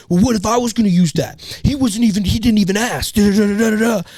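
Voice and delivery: high, loud whiny mimicry of the rude guy; mock him as a nagging whiner; mocking the angry guy. Mocking the rude gym guy, high and whiny. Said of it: "He kept being like".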